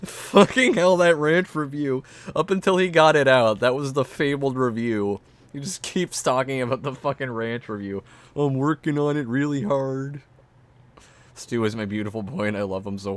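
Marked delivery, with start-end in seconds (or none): 0.0-8.0 loud, high, laughing rant; excited laughing. Real, not acted. Laughing excitedly about ranch review. 8.4-10.2 goofy higher mimic voice; Stu impression. Imitating Stu's voice.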